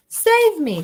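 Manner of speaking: high, loud scream. A narrator's reading. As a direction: scream for rescue. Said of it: "screamed the flesh lump eater".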